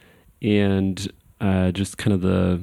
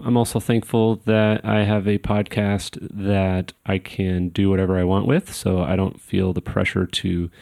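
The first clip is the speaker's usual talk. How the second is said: ordinary talk, nothing marked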